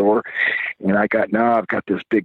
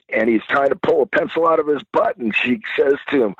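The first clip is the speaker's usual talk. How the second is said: normal talking voice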